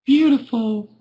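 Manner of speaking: beautiful sung out high; lilting high Beautiful; high-pitched. Acted. Answering what lindo means.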